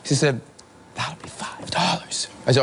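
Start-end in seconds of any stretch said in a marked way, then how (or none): 0.9-2.3 drawn-out hushed whisper, rising at the end; slow repeated whisper. Retold or imitated. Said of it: "I've got to whisper".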